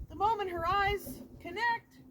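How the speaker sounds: ordinary talk, nothing marked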